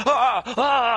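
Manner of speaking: loud, high groaning wail; hammed-up cry of strain; mock agonized cry. Exhausted magician sinking onto the bed, loud mock wail. Retold moan of exhausted wizard.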